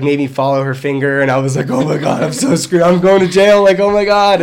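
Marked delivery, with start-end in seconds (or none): 1.7-4.4 mild panic, rising and getting louder; mock panicked babble; retold freaking out. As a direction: replay your own freak-out, building. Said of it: "I was freaking out".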